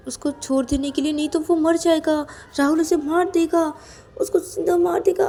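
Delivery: high, tearful begging; desperate pleading. Acted. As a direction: plead frantically, near tears. Character begs sister-in-law to intervene.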